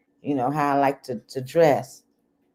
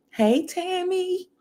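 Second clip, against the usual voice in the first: excited greeting; 12 semitones higher, 3 dB quieter, slower than usual. Real, not acted. Greeting a viewer by name.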